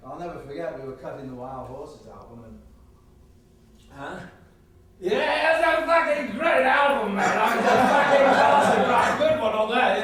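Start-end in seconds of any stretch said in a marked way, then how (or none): 5.0-10.0 high, excited shouting; loud raving. Acted. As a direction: shout out your praise like a rowdy rock star. Raving about the album.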